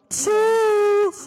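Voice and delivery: high, loud goofy goodbye; brother's brain voice. Retold or imitated. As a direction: squeal a silly bye. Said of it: "Vom Hirn von meinem Bruder".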